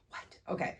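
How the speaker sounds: normal talking voice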